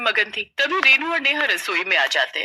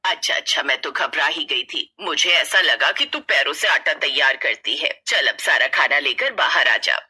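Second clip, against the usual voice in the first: mother-in-law letting it go, lower voice; relieved older woman, lower; 7 semitones lower than usual. Acted. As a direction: lower older woman, breathy "अच्छा, अच्छा" then settling. Mother-in-law relieved, sends bride out.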